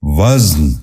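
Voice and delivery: وزن said as one syllable, "vazn"; correct pronunciation. Shown as a demonstration. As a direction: run z straight into n in وزن. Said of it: "درست تلفظ ہے".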